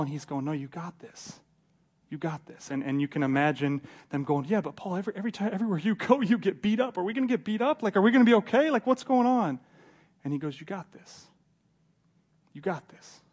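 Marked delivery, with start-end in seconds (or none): 0.4-2.7 very soft, understated encouragement; quiet reassurance. Acted. Paul reassures the worried couple he is leaving, soft reassurance. 4.4-9.6 rising, high-pitched anxious questioning; mock worried protest. Companions ask Paul if they will get beaten, mock anxious protest. 10.6-13.2 hushed, much softer encouragement; quiet reassurance. Acted. Paul reassures the couple before taking off, hushed encouragement.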